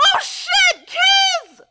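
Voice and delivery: very high, loud crying shout; excited shout. Acted. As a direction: shriek with tearful joy. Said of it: "I heard that through the wall".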